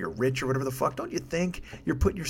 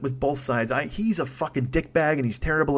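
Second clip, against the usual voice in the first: ordinary talk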